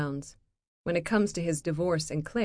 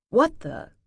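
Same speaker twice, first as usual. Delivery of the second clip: sharp, higher startled gasp of words; 6 semitones higher, 6 dB louder than usual. Acted. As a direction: caught off guard, alarmed. Startled by giant raven.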